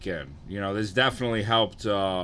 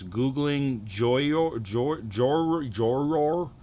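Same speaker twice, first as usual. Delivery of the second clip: halting, drawn-out sounding out; slow careful reading; 4 semitones higher, much slower than usual. Real, not acted. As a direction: stumble through an unfamiliar name, ending on a rising question. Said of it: "I gotta read this slowly".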